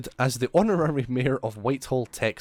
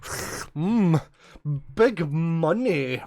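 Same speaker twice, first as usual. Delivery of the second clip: greedy voice; 4 semitones higher, much slower than usual. Retold or imitated. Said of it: "they're just like".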